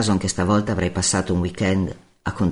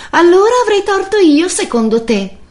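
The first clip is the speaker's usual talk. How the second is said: very high, loud female outburst; indignant shouting; 9 dB louder than usual. Acted. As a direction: shout back, outraged at being blamed. Lite urlata dopo il tamponamento. (urlo acuto e indignato)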